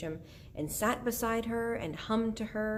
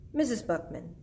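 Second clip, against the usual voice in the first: near-usual voice, before the character switch; wrong character voice; 3 semitones higher than usual. Acted. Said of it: "Sorry, wrong voice".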